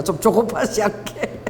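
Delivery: usual speaking voice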